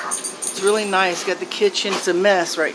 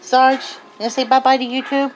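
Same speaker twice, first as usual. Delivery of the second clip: higher, louder sing-song goodbye; pet voice; 7 semitones higher, 4 dB louder than usual. Real, not acted. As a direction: chirp bye-bye for the dog. Dog says bye to viewers.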